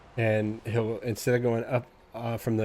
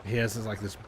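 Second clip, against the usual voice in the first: usual speaking voice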